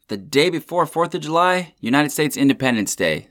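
big showman host intro; grand entrance announcement. Acted. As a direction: announce it like a ringmaster. Host opens the podcast episode.

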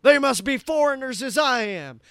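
hammed-up, loudly declaiming celebrity voice; Nicolas Cage. Nicolas Cage addressing Alabama locals as fellow foreigners, hammed-up impression. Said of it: "Nick was like".

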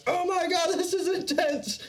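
high tripping panic; high overwhelmed voice, slowing; imitating himself freaking out on mushrooms. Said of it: "I'm kind of freaking out".

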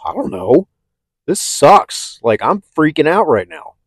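high, loud panicked outburst; freaking out. Retold or imitated. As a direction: relive the panic, voice jumping up high. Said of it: "I was freaking out".

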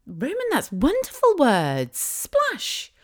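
high, sing-song motherese; gushing praise. Retold or imitated. Mum praising her son's new word, gushing praise.

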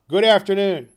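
loud, high, curt goodbye; curt dismissal. Acted. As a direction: slam the door with your voice. Scrooge dismissing nephew.

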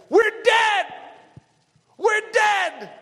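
high, loud panicked shouting; panicked cry. Retold or imitated. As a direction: cry out as a doomed crowd. Panicked Israelites voiced in sermon.

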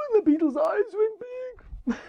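high, crying beetle panic; comic alarm. A narrator's reading. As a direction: voice rises with the beetle's fright.

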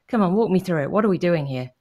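ordinary talk

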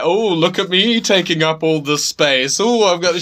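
exaggerated, teasing put-on voice; mocking mimicry. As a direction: mimic someone sprawling, drawing it out. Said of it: "like, mimicking us".

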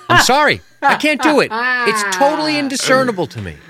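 high, loud, helpless protest; exasperated admission. Real, not acted. Host admitting he can't judge accented acting, exasperated. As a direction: throw your voice way up, pleading helplessness.

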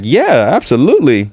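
high, bright burst of excitement; giddy. Retold or imitated. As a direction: jump at the offer like a kid. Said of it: "I was so giddy".

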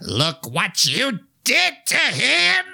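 high, slow, drawn-out shrill accusation; high unhinged accusation. Retold or imitated. Killer mother accuses the girl, high and slow. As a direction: stretch it out high and crazed. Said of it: "She has a sort of, like, high pitch".

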